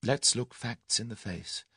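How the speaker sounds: slow, soft, firm resolve; stern self-talk. A narrator's reading. Tuppence facing facts after her cry, stern. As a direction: pull yourself together, measured and stern. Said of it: "she observed sternly".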